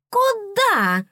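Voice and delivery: high, loud tiny fairy shout; stern fairy shout. Acted. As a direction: bark it as a bossy little fairy. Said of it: "От грозного окрика".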